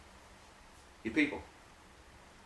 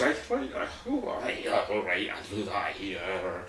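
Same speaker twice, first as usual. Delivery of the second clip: put-on old Scottish voice; 5 semitones lower, slower than usual. Players answer the coach, muddled old Scottish voice. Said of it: "the old Scottish voice".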